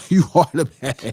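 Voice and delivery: laughing through the words. Real, not acted. To troll Todd, repeating it laughing. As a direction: say it again, breaking into a laugh.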